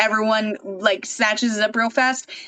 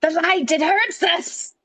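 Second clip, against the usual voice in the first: slow, higher creature voice; creepy creature voice; 6 semitones higher than usual. Pretend. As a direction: hiss it like a cave creature. Joking about light hurting.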